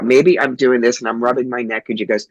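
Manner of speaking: usual speaking voice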